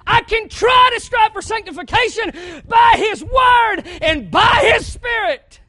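loud, high, shouting sermon voice; full-force preaching. Acted. Preacher shouting to his church about rejecting truth, full-force preaching. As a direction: drive it home from the pulpit.

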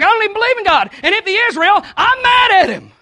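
loud, high, defiant shout of the cashier; adamant and angry. Retold or imitated. Retelling the cashier's refusal of God, loud and adamant. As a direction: spit it out like she means every word. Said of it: "so adamant".

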